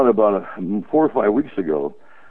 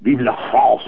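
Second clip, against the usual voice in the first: mock French accent, higher; hammy French accent; 5 semitones higher, 5 dB louder than usual. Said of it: "It's just the way they speak".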